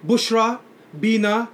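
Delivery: ordinary talk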